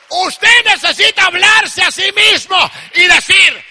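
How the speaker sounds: loud, urgent pulpit exhortation; fervent preaching. Real, not acted. Preacher urging people to bless themselves in the mirror, loud exhortation. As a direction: shout it as a rallying charge.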